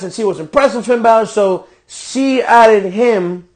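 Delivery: normal talking voice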